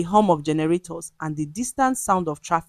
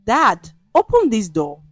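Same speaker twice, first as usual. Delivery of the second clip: loud demand through a closed door; insistent shout; 4 semitones higher, 6 dB louder than usual. A narrator's reading. Son outside the locked room calling his father, loud and insistent. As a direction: call through the door, forceful.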